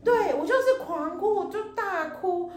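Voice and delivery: loud, high, slow exclamation of how hard she cried; wild crying recalled. Retold or imitated. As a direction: burst out loud as if reliving the sobbing.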